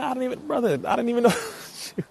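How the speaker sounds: high, laughing through the words; laughing surprise. Real, not acted. Streamer realizing his brother is in chat, high laughing surprise. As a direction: laugh it out, caught off guard.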